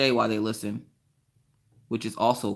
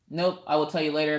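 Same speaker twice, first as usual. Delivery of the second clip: normal talking voice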